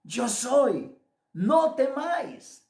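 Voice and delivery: high, slow, spaced-out proclamation; voice of Jesus. Retold or imitated. Jesus telling his disciples not to fear, proclaimed encouragement. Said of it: "Le dijo".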